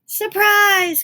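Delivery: very high, very loud gleeful shout; wolf bursting in on Granny, shouting; cried out. Acted. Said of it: "cried the wolf".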